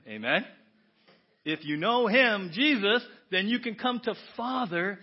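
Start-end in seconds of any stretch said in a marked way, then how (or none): none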